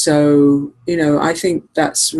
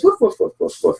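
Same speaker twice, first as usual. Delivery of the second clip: dog barking; 4 semitones higher than usual. Retold or imitated. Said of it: "So he goes".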